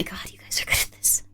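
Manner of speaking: soft whispered aside; whispered praise to the chat. Real, not acted. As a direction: murmur it under your breath.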